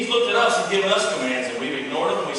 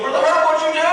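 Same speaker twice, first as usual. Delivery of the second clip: mock accusing; 10 semitones higher, 4 dB louder than usual. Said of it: "you go".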